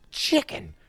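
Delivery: high put-on jeer; taunting. Film fan acts out the bully goading Marty, high-pitched taunt. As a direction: squeal it as a playground taunt.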